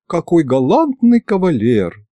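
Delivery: high, languidly admiring voice; a touch of dreamy sighing; languid admiration. A narrator's reading. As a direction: swoon lightly over a suitor. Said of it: "томно вздохнула Маша".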